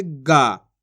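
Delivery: गा held long; drawn-out vowel. Shown as a demonstration. Teacher explaining the aa matra, गा drawn out. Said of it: "तो खींच के पढ़ेंगे".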